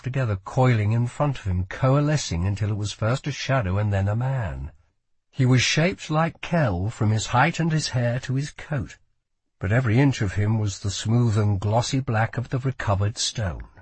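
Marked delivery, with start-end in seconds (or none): none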